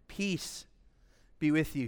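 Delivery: quiet, low greeting; hushed quote of Jesus. Acted. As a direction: say it softly and low. Quoting Jesus appearing among disciples.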